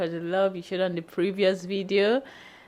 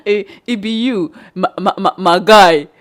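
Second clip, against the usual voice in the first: imitating Ghanaian pidgin; 9 dB louder than usual. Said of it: "I heard it a lot".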